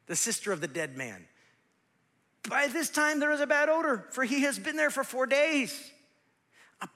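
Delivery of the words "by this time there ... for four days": high, loud reading of Martha; acted protest. Martha objecting at her brother's tomb, high protest. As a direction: push the objection up and out.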